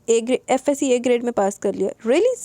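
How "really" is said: sharp high delighted gasp of Really؟; excited surprise. A narrator's reading. Husband hears wife passed her exam, excited surprise. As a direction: leap up in pitch with joy. Good news, excited reaction.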